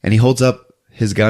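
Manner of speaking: ordinary delivery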